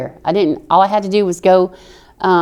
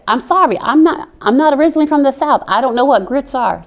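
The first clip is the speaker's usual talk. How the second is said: higher-pitched retold apology; mock apologetic protest; 6 semitones higher, 3 dB louder than usual. Newcomer explaining to two Southern girls, mock apologetic protest. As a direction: plead ignorance in a raised, imitated voice.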